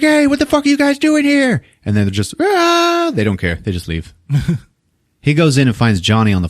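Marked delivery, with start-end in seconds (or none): 0.0-1.6 high, louder mock alarm; squeaky startled mock yell; startled shout. Lab tech startled voice. 2.4-3.1 high short mock scream; a yelped high "Ah!". Students scream and run.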